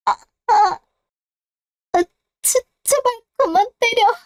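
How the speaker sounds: high, crying plea; sobbing cries under blows; pained begging. Acted. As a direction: beg them to stop hitting. Being beaten, begging to stop.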